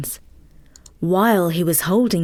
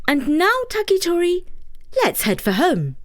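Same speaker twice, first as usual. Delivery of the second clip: higher, light fairy voice; 10 semitones higher than usual. Acted. Fairy telling Takitori she will stay, higher voice. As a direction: speak as the small fairy.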